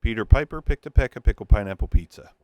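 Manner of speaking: loud, punched P sounds into the bare mic; popping plosives. Shown as a demonstration. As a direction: hit every P hard and loud. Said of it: "Let's throw some plosives into it".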